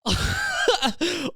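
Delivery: high sneering laugh; a mocking giggle. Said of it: "mocking myself".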